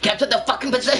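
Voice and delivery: high-pitched yelled order; hammed-up game callout; mock shouted command. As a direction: yell it high like a frantic teammate. Re-enacting annoying game callouts.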